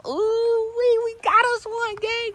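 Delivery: high, thrilled squeal of joy; excited squeal. Real, not acted. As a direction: squeal it out with delight. Thrilled at finally getting the car.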